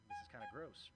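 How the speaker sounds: high squeak of disgust; mock grossed-out squeak; squeaky mock disgust. Retold inner reaction, squeaky.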